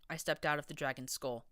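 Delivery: plain reading voice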